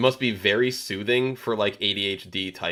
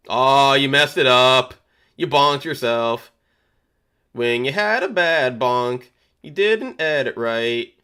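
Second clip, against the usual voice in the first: teasing; 3 semitones higher, 4 dB louder, slower than usual. Real, not acted. Teasing a player on screen.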